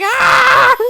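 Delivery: short high loud shriek; imitated scream. Shown as a demonstration. Streamer demonstrating her own scream, loud shriek. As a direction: belt out a sharp scream. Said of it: "that's who I'm imitating".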